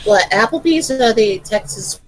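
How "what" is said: ordinary talk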